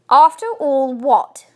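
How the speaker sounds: loud, slow challenge; firm. A narrator's reading. Wife challenging her husband's objection, firm. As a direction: cut across with a firm demand. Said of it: "voice had a firm note to it".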